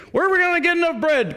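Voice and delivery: a hammed-up cry of the clueless disciples; panicked disciples. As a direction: throw your voice up high in mock panic. Disciples panic over feeding crowd.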